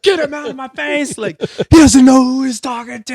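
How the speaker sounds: high, loud furious protest; acted-out outraged shouting; re-enacted screaming. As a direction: yell it past the peacemaker, still enraged. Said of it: "I'm just screaming at this guy".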